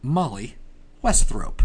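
normal talking voice

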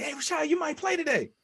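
very high squeaky excited voice; over-excited falsetto impression; amped-up buddy. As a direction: hype your friend up in a high-pitched squeal. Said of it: "always amped for his buddies".

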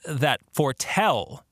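usual speaking voice

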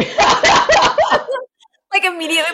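laughing, then mock annoyed at a royal; giggling through an imitated glare; laughing impression. Friend retelling the Cillian Murphy video, laughing. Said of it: "him being like".

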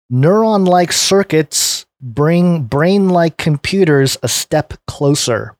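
slow, deliberate headline reading; measured announcer-like article title. Real, not acted. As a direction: read the title out slowly and clearly.

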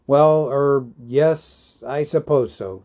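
hesitant, mildly awkward assent; stammering. A narrator's reading. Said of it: "stammered Bilbo".

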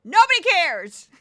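loud, high outburst at a pedant; exasperated shout. Acted. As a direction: shout down the know-it-all.

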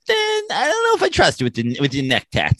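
high-pitched fussy old-man imitation; mock him with a high, fretful old voice. Co-host playing Andy doubting a smuggler, mocking old-man voice. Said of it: "old man handing away of the neck tats".

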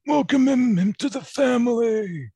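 hammed-up welcoming voice for the new member; mock welcome. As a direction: ham up a grand welcome into the family. Mock grand welcome to newcomer.

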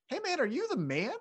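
high-pitched taunting bro voice; mocking Usos. The Usos taunting Roman in a high mocking voice. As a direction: needle him in a high mock voice. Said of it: "the Usos are like".

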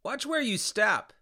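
sudden loud high warning; startled shout from below. A narrator's reading. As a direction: yell it out as a sharp warning. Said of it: "I heard somebody say".

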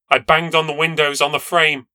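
ordinary delivery, nothing marked

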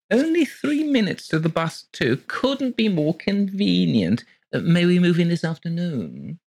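high, gushing genteel lady voice; gushing landlady. Acted. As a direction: pour it out sweetly and breathlessly high. Mrs Colgate gushing.

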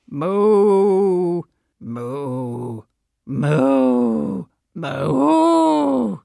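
low drawn-out cow moos; various cow moos. Shown as a demonstration. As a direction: moo like a cow in many ways. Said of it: "I'm gonna give you various moos now".

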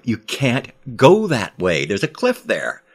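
grabbing his arm, insisting loudly; urgent warning. Acted. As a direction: hold him back with your voice.

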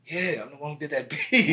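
proud reply breaking into a laugh; young producer laughing at his own fame. Retold or imitated. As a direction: chuckle through "beat". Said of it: "I was like".

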